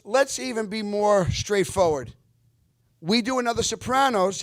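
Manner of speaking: high, loud pitch to a crowd, imitated. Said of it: "He goes".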